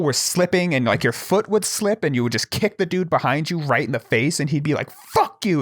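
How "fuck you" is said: higher mock outburst at the climber above; retold angry curse. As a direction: imitate a kicked climber cursing back. Retold quarrel, kicked climber curses.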